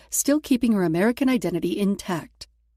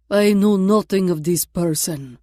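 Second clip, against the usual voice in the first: put-on loud, slow, deliberate Russian-accented denial; louder than necessary; 7 dB louder than usual. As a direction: announce it loudly for others to hear. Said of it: "said louder than necessary".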